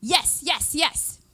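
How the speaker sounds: bright little victory cheer; cheering yes. Shown as a demonstration. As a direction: cheer it out like you just won. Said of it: "And then just go".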